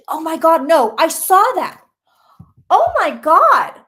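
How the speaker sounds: loud, slow shocked gasp of oh my God; stunned at seeing it come true. Real, not acted. Psychic shocked by her prediction, stunned loud exclamation. Sees explosion she predicted.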